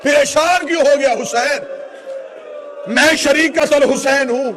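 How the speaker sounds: high, loud, impassioned mourning oratory; full-force lament preaching. Real, not acted. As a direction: cry out the tragedy to a grieving crowd.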